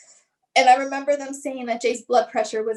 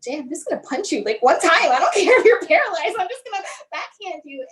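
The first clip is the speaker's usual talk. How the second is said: exasperated threat breaking into a higher laugh; laughing mock threat; 5 semitones higher than usual. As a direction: laugh through an empty threat to your kid. Mock threat to her son, laughing.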